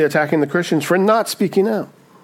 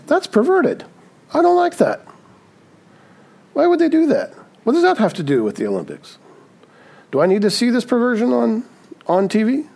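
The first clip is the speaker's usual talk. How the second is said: much higher, incredulous mock outrage; shocked disapproval; 8 semitones higher than usual. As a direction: squeak it out as if scandalized by what's on TV. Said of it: "And you said".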